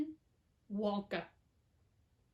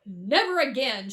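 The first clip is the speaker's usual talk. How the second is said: shouted; 6 dB louder than usual. A narrator's reading. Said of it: "shouted Mister T V".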